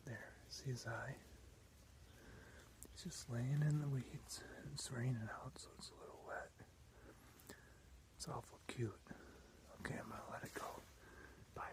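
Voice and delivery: soft hushed whisper; wildlife watching whisper. Real, not acted. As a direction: whisper so as not to scare it. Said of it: "Just whispering so I don't scare it".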